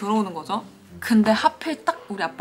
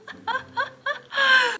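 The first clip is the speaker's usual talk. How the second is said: high bursting laugh at the memory; burst of laughter; 3 dB louder than usual. Real, not acted. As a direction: break into a laugh mid-story. Laughing while telling scary story.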